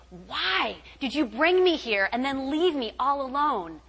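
restrained re-enactment of shouting at God; retold angry shout. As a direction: call out in anguish, holding back the volume. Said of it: "I'm just shouting out my window".

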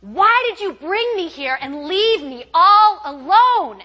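high, loud, drawn-out anguished yell; full-voiced re-enacted wail of protest; screaming at God. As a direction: scream it out slowly, word by word. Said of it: "I'm bawling and I'm screaming".

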